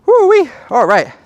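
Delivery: high, loud whoop of joy; excited cheer. Real, not acted. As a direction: whoop it out, thrilled. Cheering a fast shooting time.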